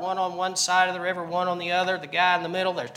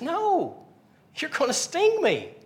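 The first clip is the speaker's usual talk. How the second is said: alarmed frog; 10 semitones higher than usual. Retold or imitated. Frog refusing the scorpion.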